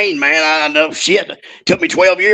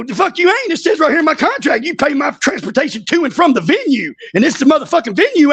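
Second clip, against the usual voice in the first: heated retold comeback; 9 semitones higher than usual. Retold argument with Jim Ross.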